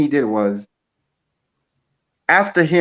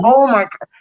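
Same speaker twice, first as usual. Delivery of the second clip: overwhelmed gasp; 11 semitones higher, 5 dB louder than usual. Real, not acted. Overwhelmed by [name]'s drum solo.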